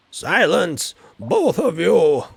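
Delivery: loud, slow booming command; commanding wizard. Acted. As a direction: shut them both up like an angry wizard. Wizard stops the fight.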